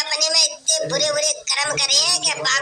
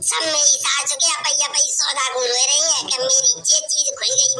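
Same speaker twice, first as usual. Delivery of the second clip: usual speaking voice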